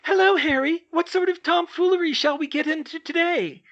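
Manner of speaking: very high, loud goofy falsetto; silly falsetto. Acted. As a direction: squeak it out like a cheerful puppet. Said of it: "spoke with a silly falsetto voice".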